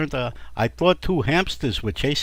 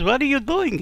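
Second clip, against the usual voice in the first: shrill wife; 12 semitones higher than usual. Acted. Wife voiced.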